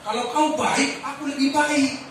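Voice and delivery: sneering everyman retort; worldly tit-for-tat voice. Shown as a demonstration. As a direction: voice the ordinary person's grudge. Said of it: "kita akan bilang begini".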